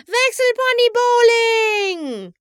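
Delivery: high, slow, drawn-out cheer; gleeful shout. Acted. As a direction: stretch it out like a party cheer. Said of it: "schrie sie vergnügt". (vergnügt und hoch gedehnt gerufen)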